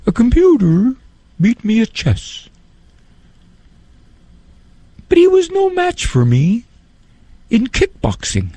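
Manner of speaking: high, sing-song comic voice; lilting, sing-songy delivery; Emo Phillips impression. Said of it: "I'll try to imitate his voice".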